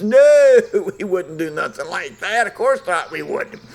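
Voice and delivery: loud high No, then protesting innocence; innocent denial. Retold or imitated. Arthur insisting to the neighbors he didn't do it, mock innocent. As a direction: play it innocent, overdoing the denial. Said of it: "And Arthur says".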